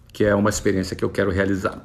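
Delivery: usual speaking voice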